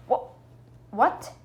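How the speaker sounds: ordinary talk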